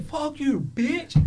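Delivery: imitating a small child cursing; little kid swearing. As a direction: squeak it out like a cheeky five-year-old. Little brother repeats the swear.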